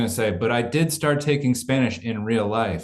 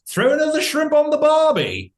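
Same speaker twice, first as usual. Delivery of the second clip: hammed-up fake Aussie accent, climbing much higher and louder; a deliberately bad Australian accent passed off as British; 5 dB louder than usual. Husband showing his wife his British accent in England, hammed-up bad Aussie accent. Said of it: "I do this bit where I'm like, "I can do an accent".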